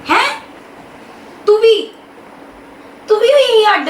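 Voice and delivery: high, startled disbelief; shocked high question; surprised. Acted. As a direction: catch her off guard and ask high. Wife hears news of separating.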